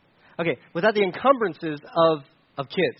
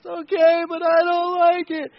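put-on high, tearful complaint of a small boy; whiny child; 11 semitones higher, 4 dB louder than usual. Son answers the parents' comfort, whining and teary. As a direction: grudgingly agree through tears. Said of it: "And just kept going".

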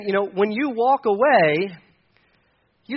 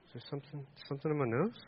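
much softer, half-hushed self-check; worried hush; 3 semitones lower, 14 dB quieter, faster than usual. Retold or imitated. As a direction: murmur it as if afraid someone hears. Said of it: "and then you're like".

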